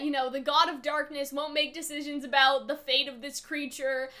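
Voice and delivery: usual speaking voice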